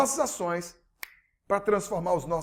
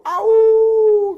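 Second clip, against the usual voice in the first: dog bark; 13 semitones higher, 12 dB louder than usual. Retold or imitated. Dog howls in story.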